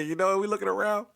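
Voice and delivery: usual speaking voice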